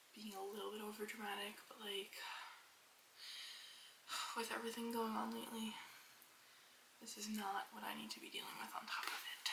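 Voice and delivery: soft unvoiced whisper. Real, not acted. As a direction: whisper it quietly.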